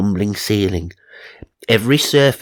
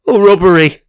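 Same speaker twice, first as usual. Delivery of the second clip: much louder, higher cry; dramatic exclamation; 11 semitones higher, 9 dB louder than usual. Acted. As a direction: announce the vision like a shock. Psychic announces a vision.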